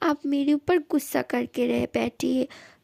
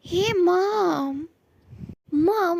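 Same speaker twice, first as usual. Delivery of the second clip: drawn-out, higher greeting call; excited greeting; 4 semitones higher, much slower than usual. Acted. Son returning home greeting his mother, excited. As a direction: call out happily to mom at the door.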